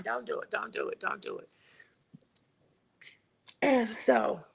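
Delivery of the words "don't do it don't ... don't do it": soft, fading don't do it; hushed warning. Retold or imitated. Recalling the sign that warned her, a soft hushed warning. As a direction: let the warning drop to a hush.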